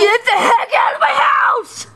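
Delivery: shrieking get out; high shout. Acted. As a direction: yell at the ghost. Angry shouting at ghost.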